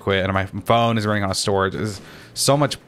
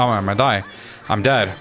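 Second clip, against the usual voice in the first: ordinary delivery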